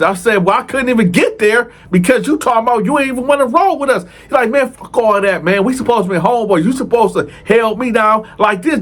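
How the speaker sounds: high, fast heated ranting; hammed-up furious cellmate; mock angry rant. Homeboy cursing out narrator, retold.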